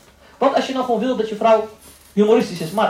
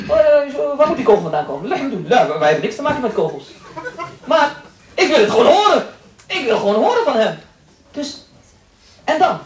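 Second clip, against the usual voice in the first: higher, slightly louder lightly voiced wife with laughs; mock wife; 6 semitones higher, 3 dB louder than usual. Lecturer voicing a wife who wants to hear words of love, higher and laughing.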